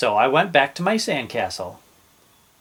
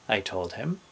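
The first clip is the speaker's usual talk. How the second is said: usual speaking voice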